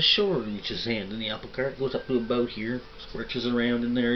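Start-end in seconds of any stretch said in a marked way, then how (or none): 0.0-0.4 pitched way up and louder, a mock old-man "Sure"; old vendor's reply. Old vendor brightly agrees with the customer, high and loud. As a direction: squeak it out like a cheeky old vendor.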